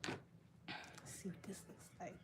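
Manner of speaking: soft slow whisper. Real, not acted. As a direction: whisper it to yourself. Unvoiced aside while checking makeup.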